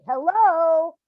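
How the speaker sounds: the mother calls out a second time; calling out again. Retold or imitated. Calling out to son running by.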